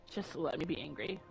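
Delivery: normal talking voice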